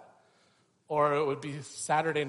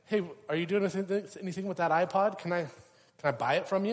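quick, higher, eager asking; eager friend; 6 semitones higher, 6 dB louder than usual. Retold or imitated. Church friend asking to buy the old iPod, quick and eager. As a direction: rush the request like you really want it.

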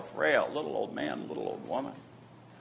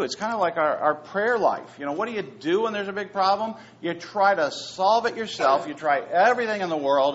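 ordinary talk

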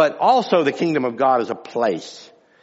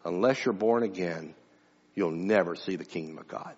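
softer, lower and grave, as Jesus speaking; hushed solemn Jesus; 8 semitones lower, 10 dB quieter than usual. Retold or imitated. Jesus answering Nicodemus at night, softer and grave. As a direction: drop to a quiet, weighty pronouncement. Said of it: "Jesus turned around to him and says".

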